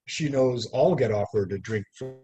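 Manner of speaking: measured, lyrical poetry reading; poem reading. Acted. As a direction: read it like a poet at an evening reading. Reads poem aloud at reading.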